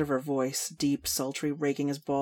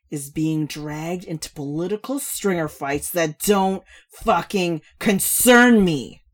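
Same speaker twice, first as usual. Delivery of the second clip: loud, high, drawling tipsy rant; slow boozy complaining; brash drunk woman; 3 semitones higher, 10 dB louder than usual. Acted.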